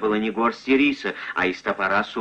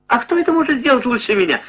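high, fast overjoyed answer; gleeful; 12 semitones higher, 4 dB louder, faster than usual. Acted. Kasim eagerly offers to lead the robbers, high and fast. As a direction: leap at the chance with joy. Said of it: "обрадованно произнёс Касим".

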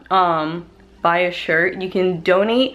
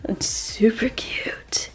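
slow, breathy whispered aside; 2 semitones higher, 6 dB quieter, much slower than usual. Real, not acted. Showing the shirt to viewers, a slow secret whisper.

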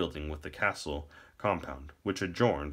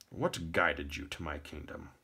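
soft, altered royal voice; old king; 2 semitones lower, 6 dB quieter than usual. A narrator's reading. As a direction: question the traveler softly, as a monarch. Old king questions the visitor.

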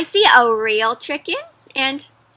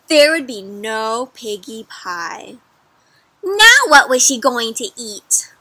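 plain reading voice

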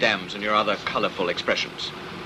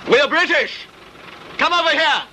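calling out. Acted. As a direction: yell it so the distant man hears. Calling out to stranded soldier.

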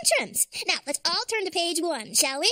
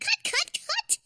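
exasperated cut call; slower than usual. Real, not acted. Director yells cut.

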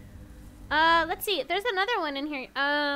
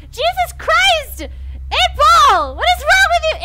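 high loud outraged yell; angry shout; 11 semitones higher, 9 dB louder, slower than usual. Real, not acted. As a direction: scold it at full voice. Yelling at the magic eight ball.

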